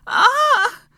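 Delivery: loud, very high yelp of pain; sharp high cry out. A narrator's reading. Hermione as her arm is crushed, crying out. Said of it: "Hermione cried out".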